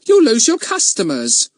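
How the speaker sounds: worried woman's high-pitched voice; fearful. A narrator's reading. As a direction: fret at your cousin. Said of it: "said Miss Kay fearfully".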